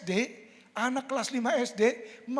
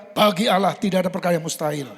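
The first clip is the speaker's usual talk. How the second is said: lower, loud and firm declaration; voice of God; 3 semitones lower, 9 dB louder than usual. Acted. Preacher voicing God's reply, loud and firm. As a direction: answer with God's certainty. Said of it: "Tapi Tuhan berkata".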